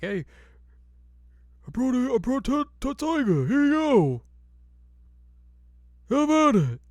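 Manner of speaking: put-on high, loud old smith voice; hammed-up high voice for the old swordsmith; Totosai.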